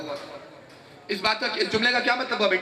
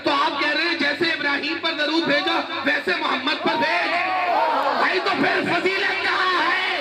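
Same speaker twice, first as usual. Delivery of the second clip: fiery preaching; 9 semitones higher than usual. Real, not acted. Preacher challenging the congregation, fiery raised preaching. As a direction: hammer the question at the crowd.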